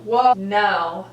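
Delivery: normal talking voice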